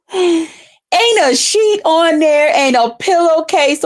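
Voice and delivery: slow, high drawn-out disgust. Real, not acted. Mocking the rival's bed, slow disgust. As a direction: stretch out the scorn.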